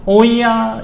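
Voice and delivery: grandma's punchline. Acted. Grandma answers.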